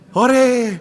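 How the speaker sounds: loud high whoop of joy; happy cheer. Acted. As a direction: cheer like a kid let off school. Child cheers holiday again.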